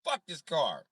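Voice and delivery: ordinary delivery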